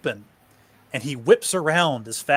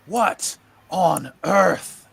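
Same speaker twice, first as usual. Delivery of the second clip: startled; 5 semitones higher, 6 dB louder, much slower than usual. Acted. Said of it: "Mark looks up at him and goes".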